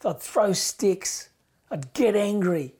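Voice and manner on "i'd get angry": ordinary talk, nothing marked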